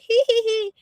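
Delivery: high mock giggle; imitated giggle. Speaker mimicking kids on a short fun visit, mock giggle. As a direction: put on a high, silly giggle. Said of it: "and be like".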